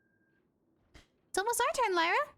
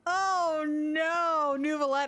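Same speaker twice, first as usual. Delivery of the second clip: panicked; 7 semitones higher, 3 dB louder, slower than usual. Real, not acted. Panics about the mailed letter.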